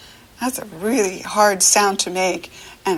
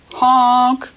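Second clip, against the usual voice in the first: louder honk; honking horn noise; 4 semitones higher, 6 dB louder than usual. Shown as a demonstration. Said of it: "some horns go honk".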